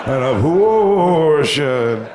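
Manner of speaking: slow, drawn-out spooky reveal. Retold or imitated. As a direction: creep it out like a ghost story. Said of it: "she said it all spooky like that".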